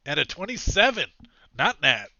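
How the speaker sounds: loud, high-pitched exclamation; excited announcement. Real, not acted. Game master announcing a big dice roll, excited shout. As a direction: belt out the score, thrilled.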